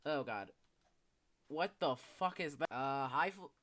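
ordinary delivery

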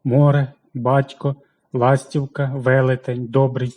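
море, батько, ластівка, велетень, добрий with the first syllable hit hard; наголос на першому складі; stress on the first syllable. Shown as a demonstration. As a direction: lean into the opening syllable of each word. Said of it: "наголошується перший склад".

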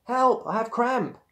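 high, loud cry of distress; crying for help. A narrator's reading. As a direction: cry out in sudden trouble. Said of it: "Suddenly she cried".

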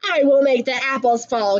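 usual speaking voice

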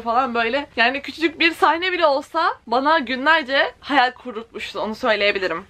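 ordinary talk, nothing marked

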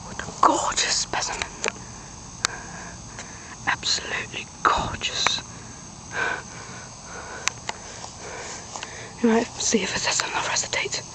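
hushed wildlife narrator whisper; nature documentary. Pretend. Nature documentary parody on dog.

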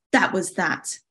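plain reading voice

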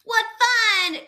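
high, loud delighted exclamation; excited cry. A narrator's reading. Frank eager to go raiding, crying out excitedly. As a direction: cry out with glee. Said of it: "cried Frank".

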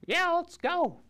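very high little dragon squeak; baby dragon voice. Pretend. Baby dragon agreeing to adventure, very high. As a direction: play the tiny eager dragon.

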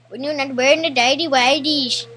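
loud Indian woman voice; Indian woman accent. Pretend. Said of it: "It's like an Indian woman accent".

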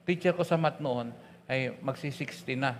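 ordinary talk, nothing marked

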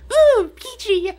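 loud, slow falsetto squeal; imitated high-pitched kid. Player imitating friend's boyhood voice, very high-pitched mimicry. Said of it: "let's see if I can imitate his voice".

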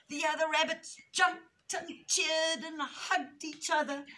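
dramatic storybook climax, rising high with stretched words; animated, triumphant picture-book reading; story reading to children. A narrator's reading. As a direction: narrate the action with big excitement.

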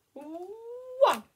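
drawn-out, high, loud "one"; stretched countdown finish, swooping upward; countdown climax. Real, not acted. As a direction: build up to a big final count. End of countdown to pupils.

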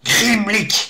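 high, spat curse. A narrator's reading. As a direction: spit the word out. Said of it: "cracha le chaman".

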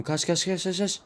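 high, urgent repeated cries; panicked run. Real, not acted. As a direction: yell at yourself to run, panicking. Fleeing a monster in game.

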